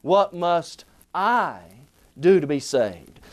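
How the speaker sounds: "I" stressed and drawn out, pause after; "I" weighted, then "do to be saved" drops low. Shown as a demonstration. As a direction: lean on the word "I", slowly. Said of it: "We could then ask it this way".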